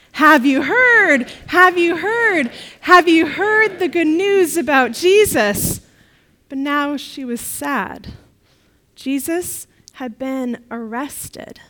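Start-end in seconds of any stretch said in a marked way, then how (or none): 0.1-5.8 loud, slow excited announcing. Acted. Mary Magdalene telling everyone, excited and loud. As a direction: burst out with the news. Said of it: "in a very excited voice".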